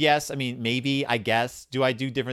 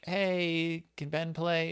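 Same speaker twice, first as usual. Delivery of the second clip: put-on light, higher-pitched kid at the door; lightly voiced hopeful kid; child asking to play; 5 semitones higher, much slower than usual. Kid asking at a friend's house if Ben can play, lightly childlike.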